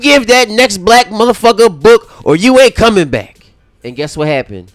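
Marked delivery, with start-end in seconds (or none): 0.0-3.4 loud, higher commanding voice; loud mock threat. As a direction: bark it like an order. Voicing colonizers' threat.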